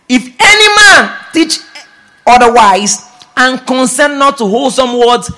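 loud, high declaimed scripture; preaching at full force. Acted. Preacher leads congregation reading scripture aloud, preaching at full force. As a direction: proclaim the verse to the whole church.

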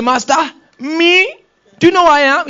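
how dare you doubt me; indignant Peter. Acted.

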